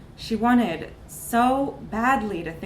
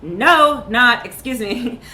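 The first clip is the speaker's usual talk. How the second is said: loud, slowed, exasperated no; loud refusal; 3 semitones higher, 10 dB louder, much slower than usual. Acted. Astronaut snaps back at pushy recruiters, loud exasperated refusal. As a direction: shout them off.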